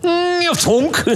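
high cow call, then the thunk of the arrow; mew and thunk. Retold or imitated. Storyteller acting out the call and the arrow hitting, high mew then thunk. Bull stepped out and goes.

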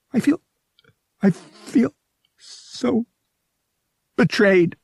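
between chattering teeth. Acted. Bria tells Vic her hurt, high and trembling. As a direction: force it out through chattering teeth. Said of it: "between chattering teeth".

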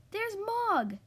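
high-pitched calling out; storybook shout. A narrator's reading. Debbie spots the cat in the road, shouted call. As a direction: call it out high, moderately loud. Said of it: "shouted Debbie".

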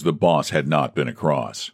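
ordinary delivery

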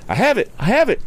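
put-on high, jubilant young voice; crying out. A narrator's reading. Tom seizes the box, high jubilant cry. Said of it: "he cried".

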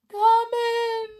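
high, loud little call from a sick bed; weak voice calling out. Acted. Sick Clockwork Clown calling visitors in from bed, high thin call. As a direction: squeak it out like a sick toy clown. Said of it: "very weak voice called out".

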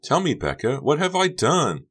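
high, pleading question; close to tears. A narrator's reading. Honey with chin trembling to Becca, tearful pleading. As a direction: plead to know, upset. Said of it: "Her chin trembled".

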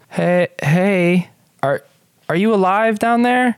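high-pitched call down into a pit; wary villager. Acted. Farmer checks if someone is alive down there, high wary call. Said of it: "and he goes".

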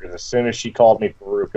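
ordinary delivery

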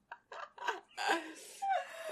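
high wheezing laugh; helpless laughter. Real, not acted. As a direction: laugh till you cry. Hysterical laughter.

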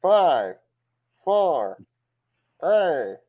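loud, measured robotic count; announcer countdown. Retold or imitated. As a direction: count down like the alert recording. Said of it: "and now they count".

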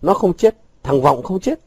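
high, insistent rebuke; angry shout. A narrator's reading. Vũ refusing to believe Vọng is dead, angry shout. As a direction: raise the pitch in sharp denial. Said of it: "Vũ quát".

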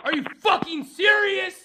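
high-pitched, incredulous anger; outraged yell. Real, not acted. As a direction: demand it in outrage. Furious rant at call staff.

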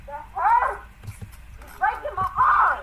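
screaming in pain; arm twisted back. Real, not acted. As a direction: cry out as the arm is bent. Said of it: "She's screaming in pain".

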